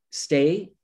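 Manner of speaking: ordinary talk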